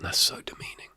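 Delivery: unvoiced whisper; whispered aside. Real, not acted. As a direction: breathe it out as a secret aside. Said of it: "whispered aside".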